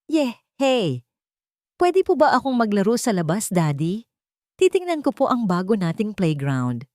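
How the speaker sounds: put-on a boy begging to go out and play; lightly voiced little kid, bubbly; excited young boy.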